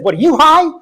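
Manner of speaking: high, loud, mock-offended comeback; incredulous retort. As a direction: fire it back at him, amazed. Dry cleaner's retort.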